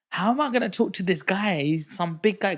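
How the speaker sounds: high, loud, flustered junior; mock panic. Young banker asking mentor about a big client, panicked. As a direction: wail it like an overwhelmed new starter.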